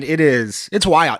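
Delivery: normal talking voice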